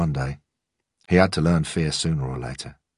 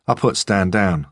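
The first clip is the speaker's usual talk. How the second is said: plain reading voice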